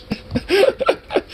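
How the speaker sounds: a high, breathy burst of laughter; high giggle. Real, not acted. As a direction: laugh at the memory. Laughing at own story.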